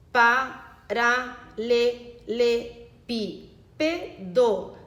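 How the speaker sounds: "Paralelepípedo" very slowly, each syllable on its own. Shown as a demonstration. Teacher checking syllable division, "Paralelepípedo" syllable by syllable. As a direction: split "Paralelepípedo" with even pauses between syllables. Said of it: "conferir agora a separação das sílabas".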